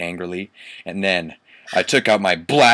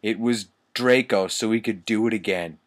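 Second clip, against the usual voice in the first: ordinary talk, nothing marked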